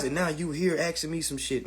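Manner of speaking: ordinary talk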